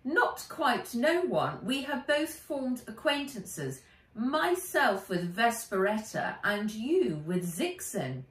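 a mock English robot in a very English accent. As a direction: play it clipped and proper, in an English accent. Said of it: "in a very English accent".

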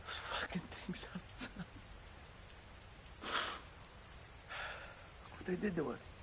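hushed, bitter whisper. Acted. Father cursing the monsters beside his daughter, bitter whisper. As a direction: hiss it so she won't hear.

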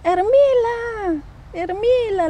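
high, slow, drawn-out call across a distance. Retold or imitated. Girl in a dream calling down to her friend, high and drawn out. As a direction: call her name from the balcony.

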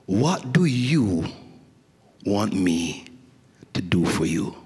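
slow and low, pausing between phrases; tender slow question. Acted. Jesus asking each listener, tenderly and slowly. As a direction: ask it gently, voice sinking low. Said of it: "he says to you individually".

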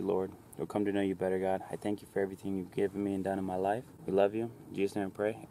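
ordinary delivery

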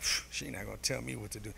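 low, very soft bravado; feigned composure. Acted. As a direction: grumble defiance under the breath, acted. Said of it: "I gotta keep composure".

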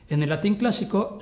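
usual speaking voice

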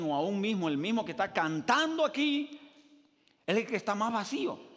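rising preaching shout. Real, not acted. Preacher building to a climax.

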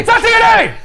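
high, loud correction; exasperated outburst. Real, not acted. Correcting the co-host's guess, loud high exclamation. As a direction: blurt it out in protest. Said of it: "correcting co-host's joke".

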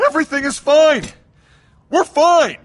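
high-pitched, insistent reassurance; anxious insistence. Acted. Debtor placating the boss owed millions, anxious insistence. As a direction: insist on it, pitch rising under pressure.

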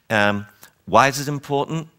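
usual speaking voice

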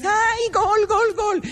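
put-on high, squeaky girlish cheer; imitating girls celebrating a goal. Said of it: "¿Cómo celebran un gol las niñas?".

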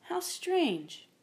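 hesitant, high-pitched puzzlement. A narrator's reading. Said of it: "hesitated and said".

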